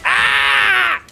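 high, loud held scream; sustained mock scream. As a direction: let out a long high "Ah!". Mock scream after nonna line.

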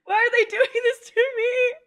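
high, loud wail through laughter and tears; tearful, about to cry. Real, not acted. Anime fan overwhelmed by a scene, tearful high wail. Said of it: "I'm gonna start crying again".

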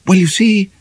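put-on high, hesitant girl's voice; timid. Ivy timidly warning the doctor, high and hesitant. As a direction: edge in timidly, afraid to say it. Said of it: "said Ivy timidly".

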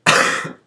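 loud hacking cough; coughing fit. Real, not acted. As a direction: cough then curse. Sick with pneumonia.

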